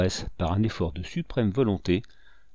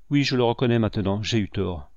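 ordinary delivery, nothing marked

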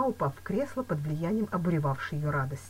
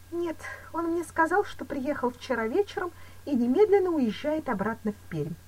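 higher, lightly voiced woman; worried Louise; 11 semitones higher, 3 dB louder than usual. A narrator's reading. Woman reporting the stranger's departure, worried. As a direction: answer with mild agitation.

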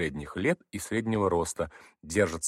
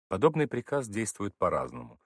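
ordinary delivery

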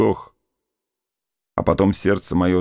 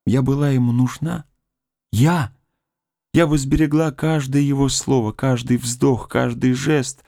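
higher, rushing plea of devotion; passionate outpouring; 6 semitones higher than usual. Acted. Grieving woman tells how she believed in him, higher and passionate. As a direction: pour it out as if no one else understood. Woman pours out her love.

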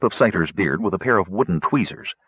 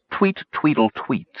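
birdlike chirping; 5 semitones higher, slower than usual. Acted. Birdcall answers Sturm about the engine in higher birdlike chirps. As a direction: chirp it like a little bird.

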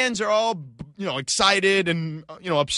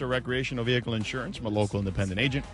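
ad read; 4 semitones lower, 4 dB quieter than usual. Acted. Host reads sponsor ad.

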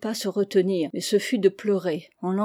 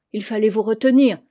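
louder, indignant reproach; furious scolding; 7 dB louder than usual. A narrator's reading. Phébé scolds Mac for sneezing, furious. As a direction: scold him for the noise. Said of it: "s'écria Phébé furieuse".

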